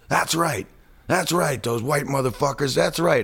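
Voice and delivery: high-pitched heckler yell; imitated yelling. Guy up front yelling during the movie, imitated yelling. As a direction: yell out in agreement at the screen. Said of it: "he starts yelling".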